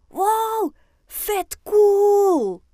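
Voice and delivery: high, loud, stretched exclamation; amazed call. A narrator's reading. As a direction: cry out in awe and delight. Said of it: "roept Fien". (opgetogen uitgeroepen)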